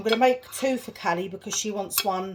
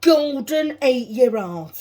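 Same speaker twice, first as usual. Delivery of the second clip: loud and slow; 5 semitones higher, 9 dB louder, slower than usual. Real, not acted. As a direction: belt it out jokingly. Loud jokey exclamation.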